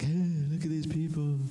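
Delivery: preacher imitating Moses griping about the crowd; mock complaint to God. Moses going back to God about these people, mock complaint. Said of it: "and he's like".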